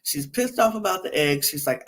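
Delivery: normal talking voice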